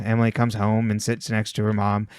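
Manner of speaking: ordinary talk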